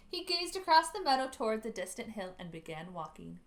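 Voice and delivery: usual speaking voice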